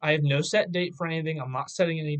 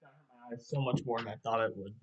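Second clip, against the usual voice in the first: ordinary talk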